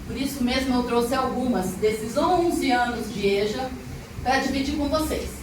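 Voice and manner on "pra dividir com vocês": ordinary delivery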